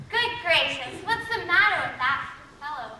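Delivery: ordinary talk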